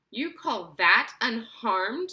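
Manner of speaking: snapping retort. A narrator's reading. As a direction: throw his word back at him. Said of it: "snapped Missus Teavee".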